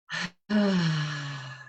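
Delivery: long audible release sigh; loud sigh. Shown as a demonstration. Teacher leading the class in a loud releasing sigh. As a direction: let it all go in one sigh. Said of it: "letting out a loud sigh".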